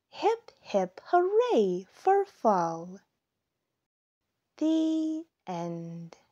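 lively storytime read-aloud; reading to children. A narrator's reading. Narrator reading a fall story to young listeners, storytime voice. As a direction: tell it like a picture book for little kids. Reads picture book aloud.

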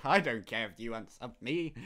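ordinary talk, nothing marked

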